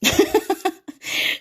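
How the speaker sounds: silent wheezing laugh; breathy laugh. Real, not acted. Host reading a viewer comment, airy laugh. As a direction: laugh without voice. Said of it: "Excusez-moi, je rigole".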